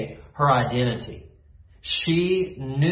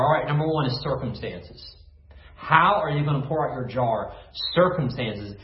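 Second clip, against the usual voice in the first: usual speaking voice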